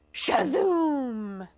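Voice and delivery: drawn-out blast-off sound; long zooming sound effect; rocket whoosh. A narrator's reading. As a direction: launch the word like a rocket. Imitates rocket launch.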